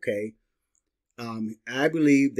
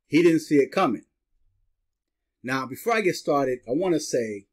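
ordinary talk, nothing marked

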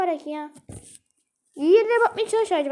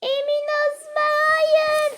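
sing-song plea; 7 semitones higher, much slower than usual. Real, not acted. Pleading at graveyard.

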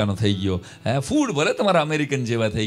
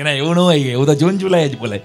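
loud protest; 9 dB louder than usual. Retold or imitated. Organizer protesting back.

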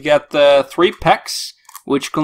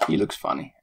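whispered aside; 11 semitones lower, 11 dB quieter, faster than usual. Shown as a demonstration. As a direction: repeat it as a private whisper.

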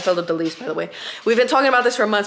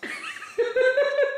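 sudden high laugh; high burst of laughter. Real, not acted. Boyfriend's taste-test reaction.

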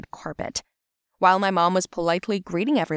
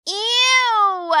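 long, high, loud squeal of disgust; 6 dB louder than usual. Acted. Little girl at spat-out grapes, loud disgusted squeal. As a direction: recoil with a drawn-out high cry.

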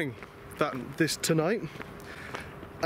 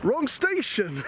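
exasperated exclaim; 7 semitones higher, slower than usual. Real, not acted. Discovering the mistake.

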